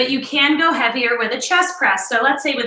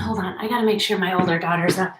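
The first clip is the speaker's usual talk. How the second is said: fast low aside; hurried aside; 6 semitones lower, 4 dB quieter, faster than usual. Real, not acted. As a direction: rush the side remark. Quick aside before calling daughter.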